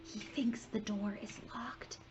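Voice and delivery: breathy hushed whisper. Acted. Harry whispering to friends behind the door. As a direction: whisper so nobody hears. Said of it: "Harry whispered".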